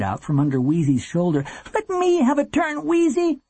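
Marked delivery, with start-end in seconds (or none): 1.7-3.4 put-on very high, pleading boy's cry; high-pitched, urgent plea; begging. A narrator's reading. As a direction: beg your sister desperately. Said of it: "he begged".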